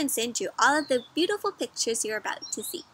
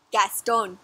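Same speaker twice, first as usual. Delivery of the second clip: plain reading voice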